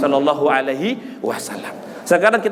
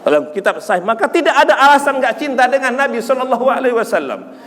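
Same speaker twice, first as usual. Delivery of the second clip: raised, emphatic sermon delivery; impassioned preaching; 5 semitones higher than usual. Real, not acted. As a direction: press the point on the congregation. Preaches at full force. (khotbah penuh semangat)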